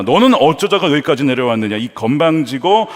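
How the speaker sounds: usual speaking voice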